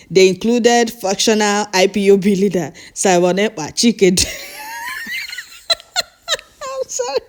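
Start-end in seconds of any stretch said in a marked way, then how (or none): 4.1-5.4 high-pitched helpless laughing fit; helpless laughter. Real, not acted. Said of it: "I don't know why I'm laughing". 6.6-7.2 real laughter, softer and higher; laughing. Real, not acted. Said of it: "I don't know why I'm laughing".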